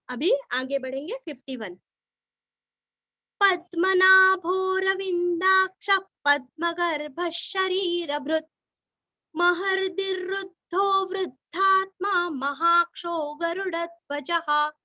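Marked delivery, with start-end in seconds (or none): 3.4-14.7 steady, even chant of the shloka; measured devotional chanting; Sanskrit recitation. Acted. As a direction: intone each line evenly for pupils to follow.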